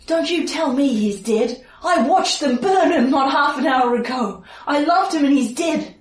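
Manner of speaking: high, anguished warning; grieving, defiant townsman. Acted. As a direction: push back in raw grief, pitched high. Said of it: "give you a bit more of his speech".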